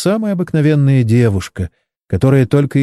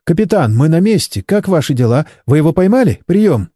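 higher-pitched, lightly hurried radio call; quick-fire questions over the radio, voiced higher; rattling into the radio; 5 semitones higher than usual. A narrator's reading. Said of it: "Чен затараторила в рацию".

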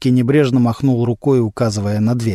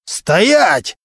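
higher-pitched, contained yell; narrator's mild shout of command; 6 semitones higher than usual. A narrator's reading. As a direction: order him to halt. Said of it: "заорал прокурор".

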